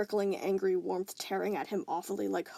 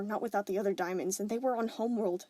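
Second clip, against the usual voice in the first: usual speaking voice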